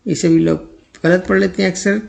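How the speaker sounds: normal talking voice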